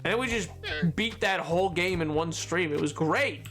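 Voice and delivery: usual speaking voice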